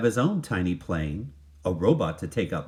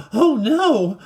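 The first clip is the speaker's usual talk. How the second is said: high, loud gasping cry; shocked boy gasping; 8 dB louder than usual. Acted. Walter seeing his street buried in trash, high loud gasp. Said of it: "gasped Walter".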